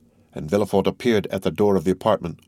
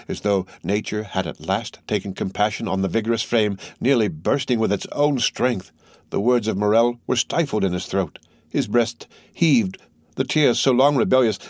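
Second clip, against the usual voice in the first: plain reading voice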